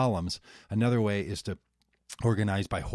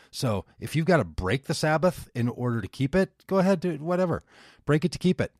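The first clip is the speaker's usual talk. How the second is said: quoting Jesus with rising higher, emphatic pitch; a lightly voiced Jesus; 6 semitones higher, 3 dB louder than usual. Paraphrasing Jesus to a friend, higher and emphatic. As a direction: make the old rule sound like an easy fix. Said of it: "Jesus ... says something like".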